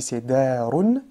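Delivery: teacher giving the example word; slow clear example word. Shown as a demonstration. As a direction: spell out "darun" slowly. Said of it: "le mot d'exemple d'aujourd'hui".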